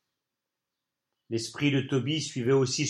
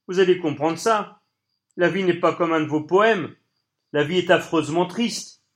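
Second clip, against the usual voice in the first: bitter raised voice; 5 semitones higher, 6 dB louder than usual. Acted. Toby bitterly lectures Colline.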